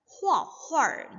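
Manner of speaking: 画画 as "hua huar", faint r at the end; slight final r. Shown as a demonstration. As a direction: curl a light, indistinct r onto the last 画. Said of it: "di belakang hua itu ada seperti ada r sedikit, tapi tidak begitu jelas". (画画 dibaca "hua huar", r tipis di akhir)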